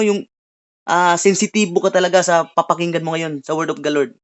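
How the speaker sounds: normal talking voice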